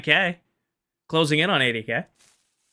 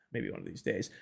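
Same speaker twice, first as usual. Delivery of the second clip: soft resigned echo; wistful mutter; 9 semitones lower, 15 dB quieter than usual. Real, not acted. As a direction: murmur it to yourself, trailing off. Repeats line softly.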